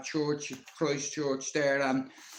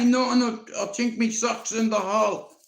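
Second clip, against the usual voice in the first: put-on higher, louder hurried boyish excuse; innocent boy excuse; 8 semitones higher, 5 dB louder than usual. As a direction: play the innocent kid caught out. Boy makes excuse to granny.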